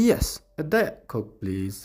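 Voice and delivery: slow "Yes, a Diet Coke", t of "Diet" dropped; cut-off Diet. Shown as a demonstration. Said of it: "ይ ቁርፅ ተብላ".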